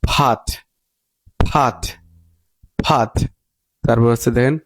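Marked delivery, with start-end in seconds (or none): 0.0-3.1 "ফাট" with an open ah vowel; American pronunciation. Shown as a demonstration. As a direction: drill "ফাট" slowly separated, vowel as আ. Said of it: "তাহলে উচ্চারণ বলবো".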